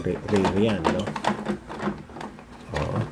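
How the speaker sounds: usual speaking voice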